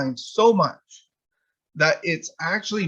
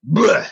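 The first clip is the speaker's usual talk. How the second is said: loud retching bleh; 5 semitones higher, 7 dB louder than usual. Retold or imitated. Puking after smelling salts.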